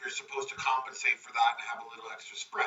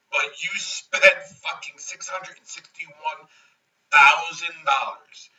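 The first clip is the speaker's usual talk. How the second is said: slow, building louder to thousand dollars; outraged emphasis; 8 dB louder, slower than usual. Retold or imitated. Pete adding up the builder's losses, slow, ending loud. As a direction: hammer each number home.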